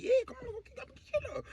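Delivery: high, tiny, soft urging; furtive acted eagerness; hushed coaxing. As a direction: egg them on in a squeaky whisper-small voice. Coaxes robbers toward the house.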